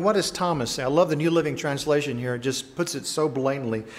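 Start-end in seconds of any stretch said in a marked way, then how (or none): none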